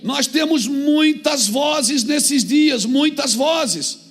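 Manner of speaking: voz de púlpito, alta e mais aguda; pregação enfática. Real, not acted. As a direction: pregue à igreja com força e insistência. Pregação com força. (pregação fervorosa)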